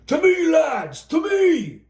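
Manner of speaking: high rallying holler. Acted. Bert calling his riflemen to him, high rallying holler. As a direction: call the riflemen to you. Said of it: "Bert waved and hollered out".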